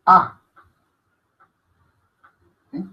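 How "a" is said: "A" said higher and louder, with a sharp lift; accented A. Shown as a demonstration. Teacher showing students the accented "A", raised and loud. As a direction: give "A" a raised, punched accent. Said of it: "es una A acentuada".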